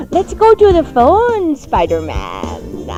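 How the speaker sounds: put-on spooky host voice, swooping downward; hammed-up Halloween voice. Hammed-up Halloween show opening.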